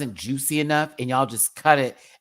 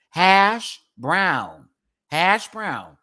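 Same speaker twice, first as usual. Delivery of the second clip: hash brown, higher and louder, stressed; emphatic hash brown; 5 semitones higher, 6 dB louder, much slower than usual. Shown as a demonstration. Correcting chat on hash browns, emphatic. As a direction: hit hash brown hard to make the point. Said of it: "the difference is hash brown".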